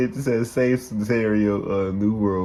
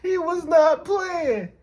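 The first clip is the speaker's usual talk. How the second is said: high-pitched laughing shriek, half crying; shrieking laughter; 6 dB louder, slower than usual. Real, not acted. Shocked laughing at the shooting.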